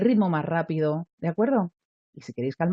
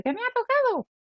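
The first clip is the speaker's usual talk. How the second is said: much higher, thrilled lottery voice; excited squeal. Shown as a demonstration. Teacher demos the too-excited voice for a solemn event. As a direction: squeal it like you just won. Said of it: "no ir con la voz de".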